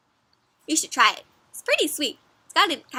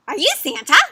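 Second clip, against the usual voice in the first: higher, loud questioning critter voice; wondering storybook raccoon; curious raccoon; 4 semitones higher, 8 dB louder than usual. Acted. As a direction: ask it as an amazed little animal. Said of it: "says the raccoon".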